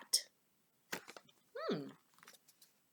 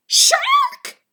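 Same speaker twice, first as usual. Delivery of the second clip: very high, loud panicked shout; 10 dB louder than usual. A narrator's reading. Fillmore shouting as he runs off, very high and loud. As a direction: scream it in terror. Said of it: "shouted Fillmore".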